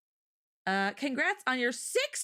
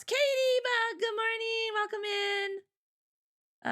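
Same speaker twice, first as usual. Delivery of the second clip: high, held singsong welcome; calling greeting; 12 semitones higher, much slower than usual. Real, not acted. Streamer greets an arriving viewer, high singsong call. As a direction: sing out a welcome, stretching the last word.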